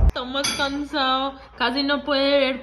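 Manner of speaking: normal talking voice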